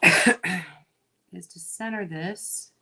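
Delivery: talking while chewing gum; words round a wad of gum. Real, not acted. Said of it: "talk and chew gum at the same time".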